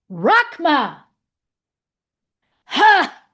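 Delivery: sudden loud shout; startling boo. Pretend. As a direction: bark it out to startle. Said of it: "Did I scare you?".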